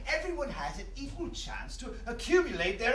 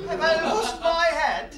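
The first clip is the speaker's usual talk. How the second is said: louder and higher, slow, laughing in disbelief; shocked laughing outburst; 8 semitones higher, 10 dB louder, slower than usual. Acted. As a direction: blurt it out half-laughing, stunned. Shocked at a talking stag.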